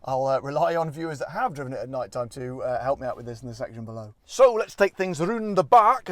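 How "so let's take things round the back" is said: loud, higher, slow put-on Scottish accent. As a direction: slip into a big, drawn-out Scottish accent. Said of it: "said that in a Scottish accent".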